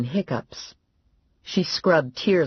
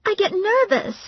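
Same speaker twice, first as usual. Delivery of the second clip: much higher, timid voice; meek Valerie. A narrator's reading. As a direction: answer shyly, embarrassed. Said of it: "Valerie said".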